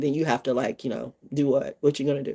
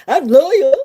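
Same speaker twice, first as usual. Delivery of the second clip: higher, louder mock dog voice; proud loyal dog; 8 semitones higher, 6 dB louder than usual. Streamer mocking pets, hammed-up proud dog. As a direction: play the dog, boasting loyalty.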